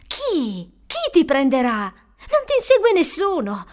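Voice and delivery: emphatic, stretched-out denial; urgent protest. A narrator's reading. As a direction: insist loudly to get through to him. Maya argues with the panicking boy.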